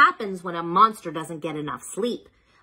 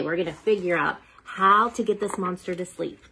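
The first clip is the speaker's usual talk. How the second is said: plain reading voice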